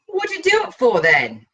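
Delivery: high, pressing question; challenging demand. Acted. Blackie presses T for a motive, demanding. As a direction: push him for a reason.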